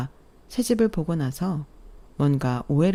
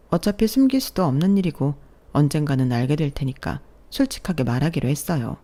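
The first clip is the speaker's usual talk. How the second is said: usual speaking voice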